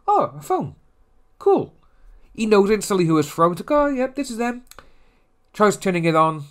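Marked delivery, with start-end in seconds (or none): 0.1-1.7 high, cartoonish little discovery; hammed-up surprise in a high voice; mock pleased surprise. Acts out recipient finding the phone. 3.7-4.6 acted realisation in a high voice; mock knowing recognition. Acts out recipient recognising the sender.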